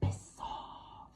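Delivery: unvoiced haunting whisper; husky haunting hag whisper; low husky voice. A narrator's reading. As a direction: breathe it like a curse. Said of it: "low, haunting and husky voice".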